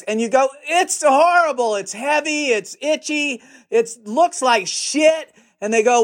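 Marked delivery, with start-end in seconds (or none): 0.7-5.3 mock complaint. Filmmaker objecting to the itchy suit handed over, complaining. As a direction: grumble through a list of grievances, pitched up.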